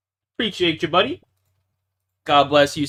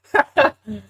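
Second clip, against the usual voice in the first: 1 laugh; 9 semitones higher, 3 dB louder than usual. Real, not acted. Laughs at own mistake.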